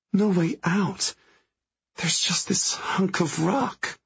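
put-on high, slow, hushed girl's voice; lightly voiced young woman, hushed; young woman voice, quiet. A narrator's reading. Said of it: "voice still quiet".

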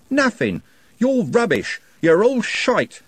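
high, loud contemptuous yell, slow at the end; furious contempt. A narrator's reading. Trev raging at the Stollop gang, furious. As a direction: spit the scorn at all of them.

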